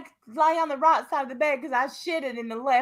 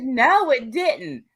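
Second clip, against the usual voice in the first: slow, stretched mock denial; drawn-out denial; 3 dB louder, much slower than usual. Retelling the couple's fight, slow stubborn denial. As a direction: drag out no it didn't. Retold quarrel reply.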